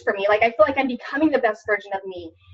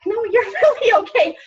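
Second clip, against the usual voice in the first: much higher angel voice breaking into laughter; laughing angel voice; 10 semitones higher, 3 dB louder than usual. Retold or imitated. Shoulder angel answering the devil, laughing, much higher. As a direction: squeak the angel's line through a laugh. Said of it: "angel and devil on my shoulders".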